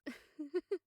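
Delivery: light affectionate laugh; fond laugh. Acted. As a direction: laugh with him. Amused that teasing works.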